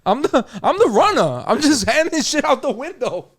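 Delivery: high, laughing mock old lady; the old drive-thru runner, imitated high and laughing. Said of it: "She like".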